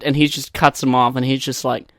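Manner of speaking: ordinary talk, nothing marked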